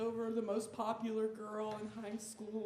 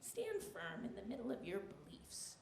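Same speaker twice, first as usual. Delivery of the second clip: grandma voice; 10 dB quieter than usual. Retold or imitated. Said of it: "She's like".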